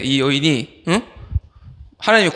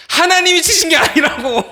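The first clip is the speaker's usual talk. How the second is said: high, loud outcry of protest; emphatic shout; 13 semitones higher, 5 dB louder than usual. Real, not acted. Preacher correcting the youths' view of God, high emphatic shout. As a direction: cry it out to correct them. Preacher cries out against the belief.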